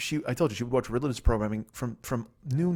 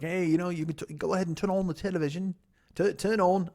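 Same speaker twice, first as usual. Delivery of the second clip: higher-pitched, put-on British accent; 5 semitones higher than usual. British housemate telling guest about the television, British accent. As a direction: attempt your British host's accent. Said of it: "I gotta do his British accent proper".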